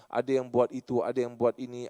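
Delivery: usual speaking voice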